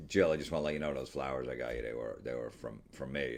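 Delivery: low, soft, halting admission; sheepish confession. Retold or imitated. Admirer confessing to Jill about the flowers, sheepish.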